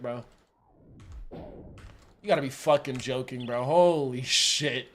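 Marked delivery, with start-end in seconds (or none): none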